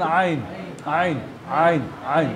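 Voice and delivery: আইন said low and softly; আইন শুনিয়ে বলা; model pronunciation. Shown as a demonstration. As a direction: model আইন slowly for the learner. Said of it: "সাউন্ডটা তুমি খেয়াল করো".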